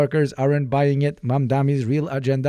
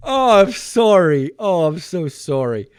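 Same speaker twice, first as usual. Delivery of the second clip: recovering from laughter; 6 semitones higher, 6 dB louder than usual. Real, not acted. Streamer apologizing after cracking up, high and winded from laughing. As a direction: let it fall from high as the laughter drains out.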